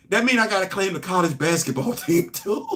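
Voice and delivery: talking into a laugh. Real, not acted. Host joking about claiming his college's basketball team, laughing. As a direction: let laughter break in toward the end.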